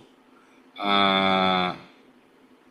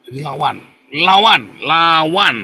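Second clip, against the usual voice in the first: louder, urgent call to fight back; rallying cry; 2 semitones higher, 4 dB louder, slower than usual. Real, not acted. Preacher urging the congregation to resist, rallying call. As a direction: chant lawan like a battle cry.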